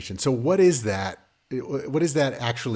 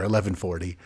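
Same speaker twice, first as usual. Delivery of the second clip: ordinary talk, nothing marked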